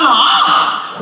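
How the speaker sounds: high, loud panicked yell; high mock scream. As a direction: yelp in terror. Dad panicking at ride.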